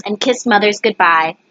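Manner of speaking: plain reading voice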